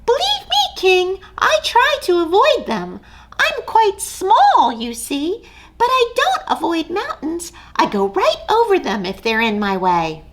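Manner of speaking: eager tiny mouse; brave little mouse. Acted. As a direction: puff up small and bold. Mouse voiced in story.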